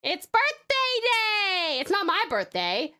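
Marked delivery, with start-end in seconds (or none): 0.0-1.8 high, drawn-out celebratory call; excited cheer. Real, not acted. Streamer hailing many birthdays in chat, excited cheer. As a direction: stretch it out high like a party cheer.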